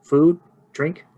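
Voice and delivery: lightly voiced innkeeper; innkeeper taking an order. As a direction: list the options as questions, voice lifted. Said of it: "She goes".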